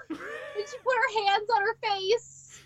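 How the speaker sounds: usual speaking voice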